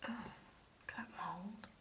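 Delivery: hushed unvoiced whisper. Real, not acted. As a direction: breathe it out in a whisper. Whispering in spooky abandoned building.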